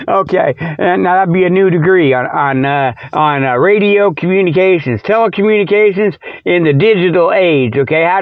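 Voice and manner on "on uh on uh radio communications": normal talking voice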